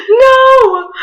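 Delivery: loud, high mock wail; hardcore crying. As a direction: wail it like you're bawling. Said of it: "like, hardcore crying".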